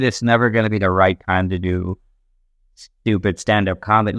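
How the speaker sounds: ordinary talk, nothing marked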